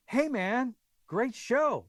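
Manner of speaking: high, eager fan greeting; retold fan's gushing hello; starstruck greeting. Voices himself greeting his idol.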